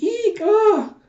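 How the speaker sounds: very high, loud mock shriek; imitated frightened squeal. Threatened townsman yelps at the woman, imitated frightened squeal. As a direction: squeal in cartoon fright, pitch shooting up.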